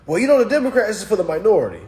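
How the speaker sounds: high, loud, rushed imitation; mock Democrat voter. Streamer imitating Democrat voters' stock answer, high mocking voice.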